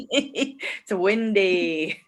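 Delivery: slow words through laughter; laughing. Real, not acted. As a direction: laugh while you say it. Laughing about the weather.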